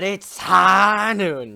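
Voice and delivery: loud, slow cowboy yell; slow loud mock showdown shout; screamed out. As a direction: bellow it like a gunslinger. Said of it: "screamed out".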